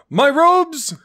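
high, loud frantic squeal; panicked shriek. Pretend. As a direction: shriek in mock panic over the robes. Wizard's robes dirtied in wasteland.

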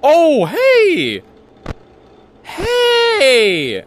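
real frightened shout; scared yell. Real, not acted. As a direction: cry out at the jumpscare. Jumpscare in horror game.

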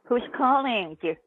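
normal talking voice

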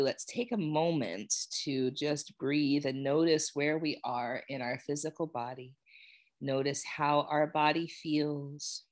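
calm, unhurried guiding voice with long pauses; guided meditation. Real, not acted. Yoga teacher guiding students to notice how they feel, meditative. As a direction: ease them gently into noticing body and breath. Leads a settling body check-in.

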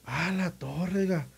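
high, urgent begging for help; imitating a frantic mother's cry; panicked plea. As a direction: plead with the policeman to cut him down. Mother begs to cut son down.

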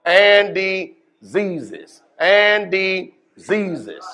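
preacher's slow hammering repeat; drawn-out emphasis. Acted. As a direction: stretch every syllable of and diseases slowly. Preacher drawing out key word.

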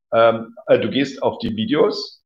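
ordinary talk, nothing marked

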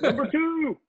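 high, playful call; high-pitched pretend holler; mock broadcast yell. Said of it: "Yell it out right now".

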